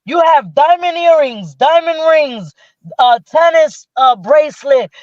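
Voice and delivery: high, singling out each item like a host; imitated game-show gushing over every prize; prize list.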